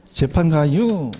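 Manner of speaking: slow Chungcheong drawl on 가유; Chungcheong accent. Shown as a demonstration. Teacher drilling students in Chungcheong dialect, slow drawl. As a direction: drag out 재판 가유 the Chungcheong way. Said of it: "뭘 가자고?".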